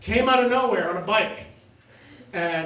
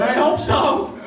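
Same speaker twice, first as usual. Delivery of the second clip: laughing reply; 6 semitones higher, 6 dB louder, slower than usual. Pretend. His reply to the leaf.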